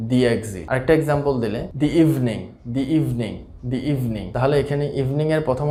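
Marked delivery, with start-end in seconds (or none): 0.1-0.6 "the exit" as "di exit"; long ee on "the", then exit. Shown as a demonstration. Teacher models di exit for the class. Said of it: "উচ্চারণ করছি দি". 1.8-4.3 "the evening" as "dee evening"; long ee in "the" before evening. Shown as a demonstration. Teacher demonstrates di evening for learners. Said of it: "আমরা উচ্চারণ করছি দি".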